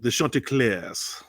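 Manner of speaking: lightly attempted French accent on Chanticleers. Shown as a demonstration. Co-host playfully trying his best French on a team name, French accent. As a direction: have a go at a French pronunciation. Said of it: "French accent".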